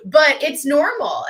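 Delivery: high giggle breaking into the words; laughing it off. Real, not acted. Telling viewers about therapy, giggling through it. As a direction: laugh through the line, lightly.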